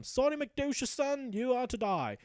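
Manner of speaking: high, slow pompous sentencing; mock grand doom. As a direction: pronounce his death theatrically. Hrothgar pronounces doom on knight.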